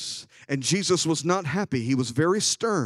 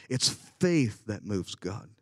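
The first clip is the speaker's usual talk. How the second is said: faith leaned on, lower and softer, slow; stress on faith; 7 semitones lower, 5 dB quieter, slower than usual. Real, not acted. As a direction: drop low and slow on faith. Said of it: "contrast need and faith".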